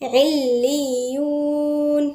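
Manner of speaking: عليون slowly, with doubled lam and ya; doubled letters. Shown as a demonstration. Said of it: "الاتنين مشدد".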